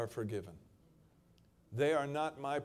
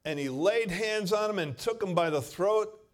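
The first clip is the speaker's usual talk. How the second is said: loud, higher, intense narration; dramatic preaching; 8 semitones higher, 11 dB louder than usual. Acted. Preacher describes the servant choking his fellow, loud. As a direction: paint the violence vividly.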